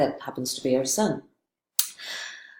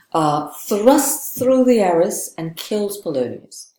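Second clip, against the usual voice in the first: ordinary talk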